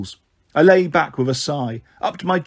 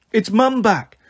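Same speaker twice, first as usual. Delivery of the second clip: excited yell; 7 semitones higher, slower than usual. A narrator's reading. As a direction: call out that Mum is home. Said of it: "Baxter yelled".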